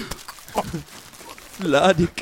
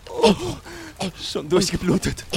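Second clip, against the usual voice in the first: ordinary talk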